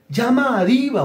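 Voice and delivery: high, pious voice parroting an order from the Lord; mock divine order. Preacher mocking a flatterer who claims the Lord spoke, high pious voice. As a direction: sing out the holy instruction in a falsetto. Flatterer quoting what God told her.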